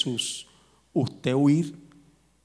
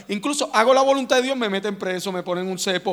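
higher and louder, pleading why; indignant outburst; 6 semitones higher, 3 dB louder than usual. Retold or imitated. Jeremiah protesting his suffering, loud and high. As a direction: cry out like a wronged prophet. Prophet's complaint retold.

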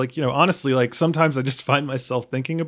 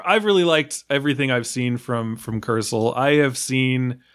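ordinary talk, nothing marked

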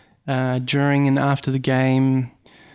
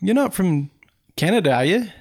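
higher-pitched mock Canadian driver; imitated Canadian accent; 5 semitones higher than usual. Driver quizzing his passenger, imitated Canadian accent. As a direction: ask in a put-on Canadian lilt. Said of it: "sorry, I apologize for the Canadian accent".

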